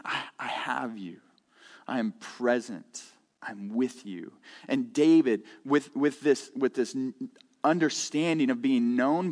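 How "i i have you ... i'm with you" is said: soft near-whisper. Acted. God's comforting words, read softly.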